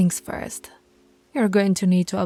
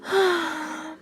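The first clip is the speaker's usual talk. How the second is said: high, airy sigh; drawn-out sigh; 9 semitones higher than usual. Acted. Alpha reacting to her pup, long sigh.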